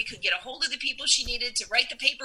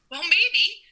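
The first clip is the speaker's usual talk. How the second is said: teasing; 6 semitones higher than usual. Retold or imitated. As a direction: play it sly and knowing. Teasing admission.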